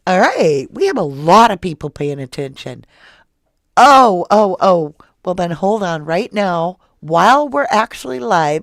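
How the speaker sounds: loud, thrilled burst to the live chat; excited shout. Real, not acted. Host excitedly telling live viewers many are watching, loud and thrilled.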